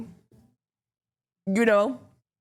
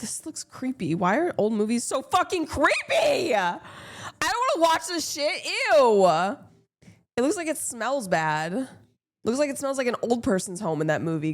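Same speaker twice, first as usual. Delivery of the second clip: grossed out; 5 semitones higher than usual. Real, not acted. Disgusted by old movie.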